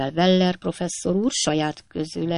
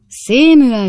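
"Samuel" called out much louder and higher, a father's loud reproach; reproachful call; 6 semitones higher, 10 dB louder than usual. A narrator's reading. Old father rebuking his son Sam, loud reproachful call. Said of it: "szemrehányó hangon".

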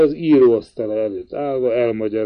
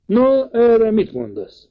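high, slow, insistent uncle; agitated old uncle demanding a reply; uncle's pressing question; 11 semitones higher, 3 dB louder, slower than usual. A narrator's reading. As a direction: press K for an answer, voice lifted high. Uncle questions K after reading letter.

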